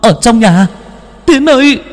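high, loud pleading cry; desperate warning shout. Acted. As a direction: beg him to stay inside. Beaten boy warns friend away. (hét lên van nài)

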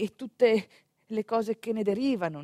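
ordinary talk

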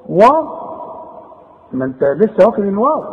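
ordinary talk

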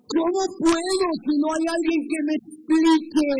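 súplica aguda y fuerte; súplica del eunuco. Acted. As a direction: implora que alguien le explique. El eunuco pide ayuda. (el eunuco suplica en voz alta y aguda)

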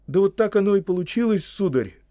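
high, loud, quick justification; excitable gardener owning up; making excuses. Acted. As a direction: explain yourself like a flustered servant. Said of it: "оправдывался Сэм".

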